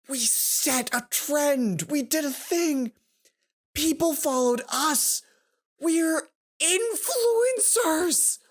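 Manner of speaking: giddy, rising squeals of delight; bursting with pride at the news; thrilled high voice; excited. Acted. Excited about the trend.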